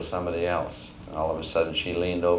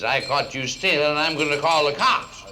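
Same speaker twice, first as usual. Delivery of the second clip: indignant threat; 6 semitones higher, 5 dB louder than usual. Acted. Boss repeats his threat.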